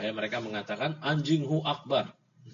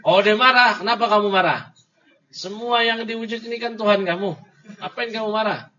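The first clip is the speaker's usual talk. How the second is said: raised, higher-pitched challenge; mock challenge; 6 semitones higher, 6 dB louder than usual. Teacher challenging an imagined angry opponent, mock indignant. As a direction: act out a taunting confrontation.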